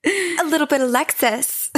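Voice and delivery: put-on light high valley-girl Alexis voice; a hint of Alexis from Schitt's Creek; Alexis impression. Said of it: "I'm Alexis".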